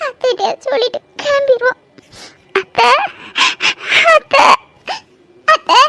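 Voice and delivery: sob, then call out for her; crying girl calling her aunt; tearful calling out. Acted.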